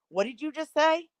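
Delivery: retold incredulous question. Friends' startled question, retold.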